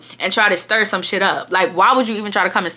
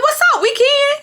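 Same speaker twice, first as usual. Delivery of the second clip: very high comeback with a laugh; mock bravado. First-time fighter taking up a challenge, mock bravado. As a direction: accept the fight, squeaky and half-laughing.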